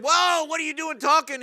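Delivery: high, loud startled exclamation; Balaam recoils, shouting at his talking donkey; startled cry. Retold or imitated. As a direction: jump back in shock at a talking donkey.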